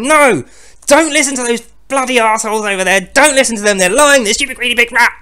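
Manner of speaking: high, loud ranting god; foaming at the mouth. Retold or imitated. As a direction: spit it out in a frenzied rage. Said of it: "foaming at the mouth".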